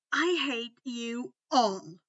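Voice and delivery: slow loud huff. Acted. Malfoy huffing at his friends, mock annoyed. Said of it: "He huffed at the end".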